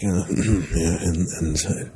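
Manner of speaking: low, soft, halting announcer imitation; hesitant low mumble with a false start; David Kaye. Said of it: "David Kaye's like".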